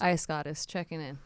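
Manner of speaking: quiet radio call-in; over walkie talkie. Pretend.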